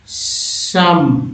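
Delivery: सम said slowly with a drawn-out opening s; teacher sounding out सम; word read out. Shown as a demonstration. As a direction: stretch the hiss at the start of सम. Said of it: "पढ़ते हैं".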